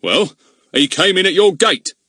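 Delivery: loud, indignant village constable; blustering policeman. Acted. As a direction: bluster like a suspicious bobby. Narrator voices Goon.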